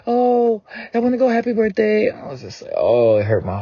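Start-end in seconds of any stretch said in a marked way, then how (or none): 0.1-2.1 excited little daughter. Retold or imitated. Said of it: "She's like".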